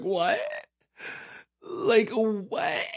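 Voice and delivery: high, soft baffled disbelief; imitating a stunned teen, rising; baffled what. Teen stunned by her grandma's old car, baffled rise.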